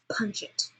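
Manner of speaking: whispered then punched. Shown as a demonstration. Teacher showing how articulation adds emphasis. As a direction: hit it hard after mumbling. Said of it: "really pay attention to something".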